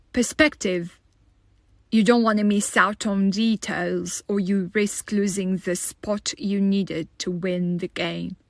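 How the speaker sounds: ordinary talk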